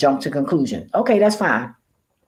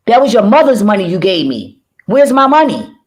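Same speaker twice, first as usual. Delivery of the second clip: raised, demanding retold rant; acted demand for repayment; mock confrontation; 6 semitones higher, 5 dB louder than usual. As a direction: demand the money back, loud and high. Voicing confrontation with the borrower.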